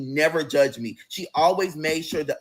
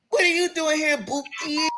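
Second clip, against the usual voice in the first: surprised; 11 semitones higher than usual. Retold or imitated. Surprised by knock on door.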